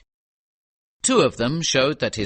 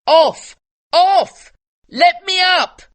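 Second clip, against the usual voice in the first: put-on high, loud child's shout; 9 dB louder, slower than usual. Little boy shouting at Kim to get down, high and loud. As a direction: yell to get him off the cannon. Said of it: "shouted at Kim".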